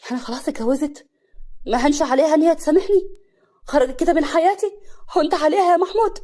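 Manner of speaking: high, loud, rising questions; anguished disbelief. Acted. Hassan learning his former wife has married, anguished disbelief. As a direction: build from stunned question to wounded outcry.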